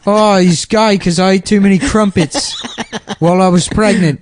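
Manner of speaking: high hammed-up fake South African accent, laughing; mock Aussie-ish South African voice; a friend doing a terrible South African dad; bad South African accent. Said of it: "our version of the South African accent".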